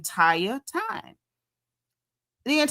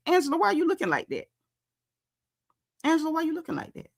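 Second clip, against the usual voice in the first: quick, higher needling question, said twice; mock Portia voice; 5 semitones higher, 4 dB quieter, faster than usual. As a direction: poke at Angela fast and high.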